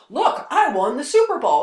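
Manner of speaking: a ring thief showing off a prize he never won; mock braggart. Ring thief bragging to everyone, loud mock boast. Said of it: "someone stealing the Super Bowl ring ... going".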